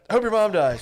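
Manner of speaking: high, loud imitated curse; hammed-up retort, close to laughing; mock angry shout. Acted quarrel, officer's curse retold.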